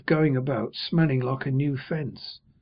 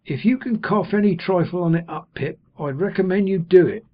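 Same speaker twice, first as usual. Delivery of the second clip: lightly voiced Joe, higher and alarmed; aghast; 6 semitones higher, 5 dB louder than usual. A narrator's reading. Joe pleading with Pip, a touch aghast. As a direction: urge him, half alarmed. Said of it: "all aghast".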